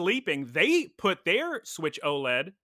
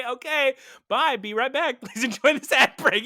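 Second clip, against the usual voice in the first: imitating her cohost; 8 semitones higher than usual. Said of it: "Normally you'd say".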